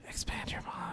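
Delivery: hushed, breathy whisper. Real, not acted. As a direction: whisper it back softly. Repeats phrase as whisper.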